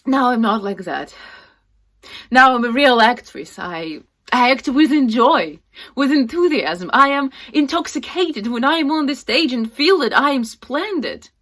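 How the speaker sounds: loud, high, impassioned declaration; exultant proclamation. Acted. Actress declaring she acts with joy, loud exultation.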